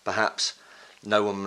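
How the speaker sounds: normal talking voice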